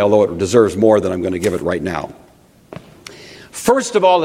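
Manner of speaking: ordinary talk, nothing marked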